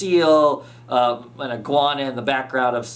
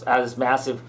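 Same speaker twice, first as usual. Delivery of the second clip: ordinary talk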